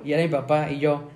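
normal talking voice

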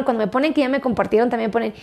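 ordinary talk, nothing marked

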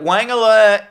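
loud, high announcer-style call; mock alert. Host flagging the running gag, mock excited alert. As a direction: sound a hammed-up alarm.

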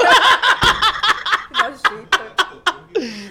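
loud high laugh; high laughing fit. Real, not acted. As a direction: laugh hard at your own joke. Laughs at own joke.

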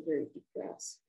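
breathy, voiceless confession of depression; a retold, hushed admission to the stranger; whispered admission. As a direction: let the word depressed fade to a whisper.

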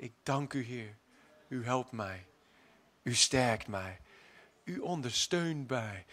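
soft, low whispered-down prayer; hushed prayer. Acted. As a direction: breathe the thanks out quietly. Prayer said quietly.

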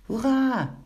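high, loud cheer; jubilant shout. Acted. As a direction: cry out in triumph at the find. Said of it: "riep Pinkeltje".

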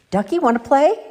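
high, loud call to a playmate; eager yell. A narrator's reading. Peep bursting in to ask Ducky to play, excited yell. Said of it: "In comes Peep yelling".

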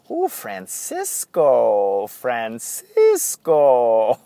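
very slow, drawn-out Francisco, Francisco; imitating Francisco greeting. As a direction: stretch each Francisco out lazily. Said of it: "he goes".

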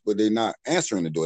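ordinary delivery

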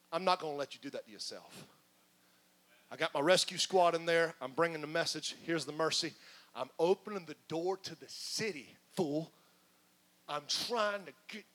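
hushed exasperated God through his teeth; like a parent holding back frustration; God speaks low and strained, through teeth; through gritted teeth. Acted. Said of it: "talking through your teeth".

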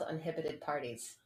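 ordinary delivery

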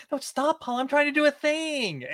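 high mock-whiny brush-off; [name]'s brushing-off voice. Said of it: "[name] shooing Paula".

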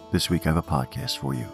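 ordinary delivery, nothing marked

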